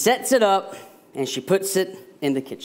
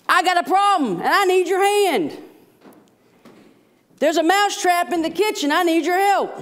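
much higher, louder squeaky mouse; pleading mouse; 5 dB louder than usual. Acted. Mouse begging the chicken about the mousetrap, high squeaky plea. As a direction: squeak out an urgent plea for help. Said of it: "And he says".

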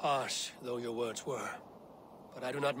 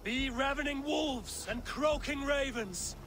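rallying battle cry; 12 semitones higher than usual. Acted. Eivor rallies the warriors before the final battle, shouting. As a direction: whip the army into a fury.

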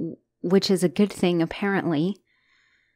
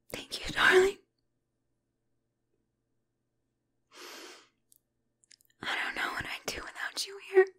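high, soft unvoiced whisper; tender whisper; 12 semitones higher, 7 dB quieter than usual. Girlfriend thanking her partner for staying, in a high whisper. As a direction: whisper your thanks, fragile and grateful.

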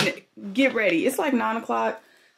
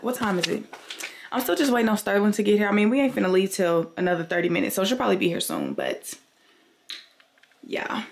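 usual speaking voice

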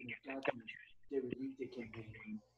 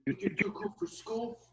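mocking taunt; 10 semitones higher, 5 dB louder than usual. Mocking rival in confrontation.

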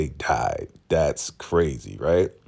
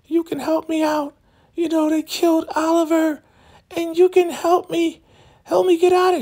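very high falsetto imitation of a pleading woman; hammed-up falsetto begging; mock desperate plea; 4 dB louder than usual. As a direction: squeal the plea in a put-on high voice, louder toward the end. Imitates trapped woman pleading for help.

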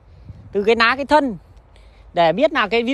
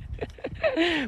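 amused laugh; 6 semitones higher, 11 dB quieter than usual. Real, not acted. Laughs at own joke.

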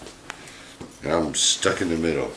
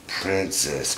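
ordinary talk, nothing marked

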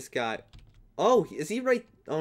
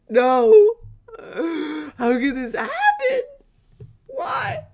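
very high, laughing-crying despair; hammed-up heartbreak, very high; mock anguish; 9 dB louder, slower than usual. Real, not acted. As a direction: wail it, half laughing, half fake sobbing. Teammate just lost.

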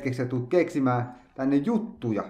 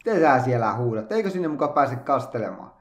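normal talking voice

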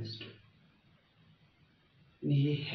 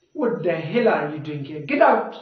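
mock-angry, louder toward the end; angry outburst; 6 dB louder than usual. Shown as a demonstration. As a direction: act out an irritated teacher erupting into a shout. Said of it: "used to emphasize feelings of anger".

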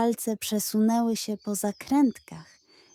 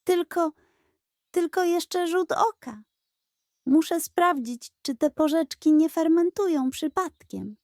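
higher, earnest excuse-making; pleading pug; 6 semitones higher than usual. Acted. Pug making an excuse to the goat, higher. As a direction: sell the fib with wide eyes.

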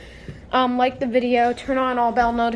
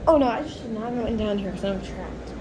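ordinary talk, nothing marked

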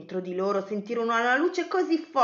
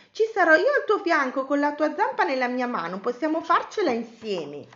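raised, eager voice settling at the end; encouraging; 6 semitones higher, 3 dB louder than usual. Acted. Betty promises to stay beside Cosmo, encouraging. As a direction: cheer a friend on, starting high.

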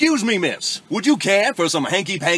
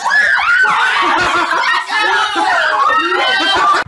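high evil cackle; 4 dB louder than usual. Acted. Villain laugh.

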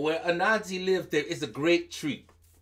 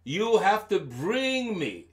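ordinary delivery

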